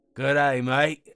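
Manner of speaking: a relaxed Aussie bloke saying hello; Australian greeting. A narrator's reading.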